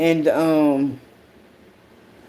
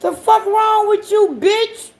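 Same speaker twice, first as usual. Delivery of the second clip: much higher and louder yell; high, loud what's-wrong-with-you; shrieking disbelief; 4 dB louder than usual. Real, not acted. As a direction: scream it at him in shock. Yelling at son in disbelief.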